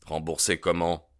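ordinary delivery, nothing marked

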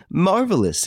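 high-pitched burst of delight; delighted exclamation. A narrator's reading. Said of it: "exclaimed Daspry, delighted".

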